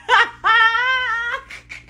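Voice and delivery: overjoyed high laugh; real laugh of relief; high delighted laugh. Real, not acted. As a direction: burst out laughing with joy. Laughing at good news.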